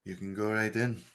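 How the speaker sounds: a hint of a weary guard; lightly voiced guard. GM voicing NPC.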